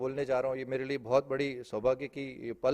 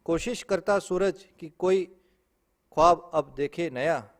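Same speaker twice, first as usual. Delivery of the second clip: poetry recitation; 2 semitones higher, 5 dB louder than usual. Acted. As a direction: declaim it with pauses, easing off at the end. Repeats the verse. (बुलंद शुरुआत वाला काव्य पाठ)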